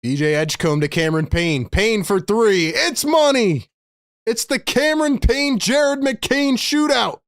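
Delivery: high-pitched, thrilled basketball call; excited play-by-play. Real, not acted. Streamer calls made threes for viewers, excited play-by-play.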